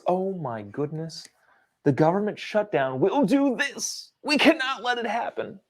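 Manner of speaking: hammed-up fake panic, pitched high; mocking media alarm. Host parodying how the media frames the shutdown, in mock panic. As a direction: mimic a breathless news anchor.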